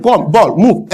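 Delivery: ordinary delivery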